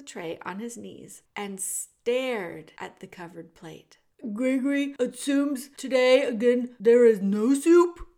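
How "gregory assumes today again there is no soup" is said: put-on loud, booming old jailer who speaks of himself by name; the jailer Gregory greeting Meg in a big theatrical voice. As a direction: boom it out like a grand old jailer. Gregory complains about soup.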